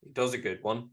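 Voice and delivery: ordinary talk, nothing marked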